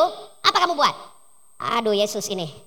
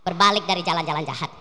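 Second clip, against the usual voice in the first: normal talking voice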